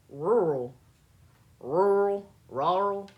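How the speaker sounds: "Rural" tried loudly with the two Rs smeared; struggling to say rural. Real, not acted. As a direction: push out "Rural" as a tongue-tangled attempt. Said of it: "I can't say it".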